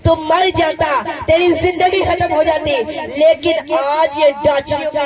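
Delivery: loud, measured pronouncement from a saintly elder; slow, weighty rebuke and blessing; preacher voicing the Imam's solemn words, loud and slow; solemn holy voice. Holy figure addresses Abu Samsam.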